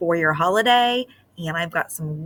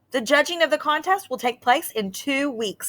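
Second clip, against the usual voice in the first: higher official announcer voice; mayor announcing; 8 semitones higher than usual. Acted. Mayor telling the mice about the judging, announcing. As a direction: announce the rules like a small-town mayor. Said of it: "said the mayor".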